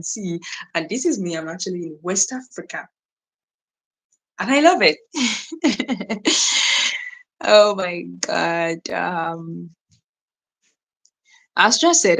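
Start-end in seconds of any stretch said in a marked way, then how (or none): 5.0-7.1 delighted giggling laugh; real, happy giggle. Real, not acted. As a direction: giggle in a quick run. Laughs after saying she loves it.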